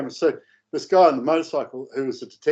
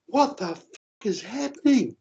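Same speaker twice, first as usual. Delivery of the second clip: mock scream; 8 semitones higher than usual. Woman across the road watching the scene, mock scream. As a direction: imitate a bystander shrieking in disbelief. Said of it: "It's like screaming".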